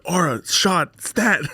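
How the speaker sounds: high, urgent mock emergency order; doctor giving orders. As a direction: call for it like a surgeon in a crisis. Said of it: "I feel like a doctor".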